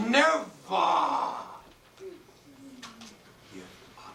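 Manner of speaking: booming high first word, long pause, then hushed finish; orator's declaration. Retold or imitated. Churchill's third time at the microphone, thundering then hushed oratory. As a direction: thunder the first word, drop away on the rest. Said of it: "and he said".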